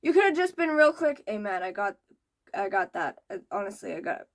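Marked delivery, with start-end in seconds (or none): 1.3-4.2 low, soft, sheepish confession fading to whisper; mock sheepish apology. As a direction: shrink into a whispered sorry. Mock apology he should have made.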